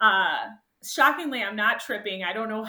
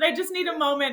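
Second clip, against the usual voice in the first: high, tearful asking for space; tearful plea; 7 semitones higher, 4 dB louder, faster than usual. Retold or imitated. Runner stepping away from her friend, tearful plea. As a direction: beg off gently, close to crying. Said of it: "And I said".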